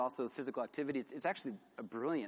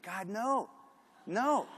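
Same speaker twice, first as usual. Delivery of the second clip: rising, appalled God no; horrified no; 7 semitones higher, much slower than usual. Retold or imitated. To the client wanting a logo, an appalled no. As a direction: recoil at the idea, pitch climbing.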